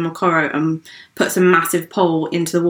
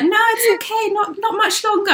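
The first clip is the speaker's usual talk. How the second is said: a casual, dismissive guide; imitating the guide; 13 semitones higher than usual. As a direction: guide voiced much higher, lightly mocking. Guide reassures the scared tourists.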